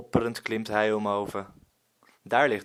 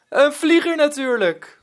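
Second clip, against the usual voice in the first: loud, much higher proud exclaim; triumphant call; 10 dB louder than usual. Acted. Pirate Willem shows the boy the kite he made, loud proud call. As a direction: show off the surprise with glee. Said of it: "roept Willem".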